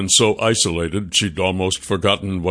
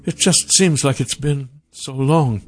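higher voice, lingering on the words; wistful Sasha; 6 semitones higher than usual. Acted. Sasha tells the stranger about sunshine, wistful. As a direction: savor it in a higher character voice.

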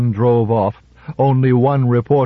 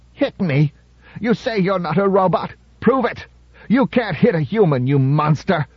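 high, shrill-pitched jeering challenge; taunting heckler; 8 semitones higher than usual. A narrator's reading. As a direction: goad him to strike, voice climbing. Thin man taunting Byerly.

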